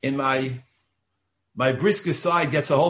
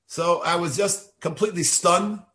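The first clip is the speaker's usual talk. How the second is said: ordinary talk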